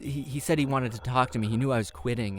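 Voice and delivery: ordinary delivery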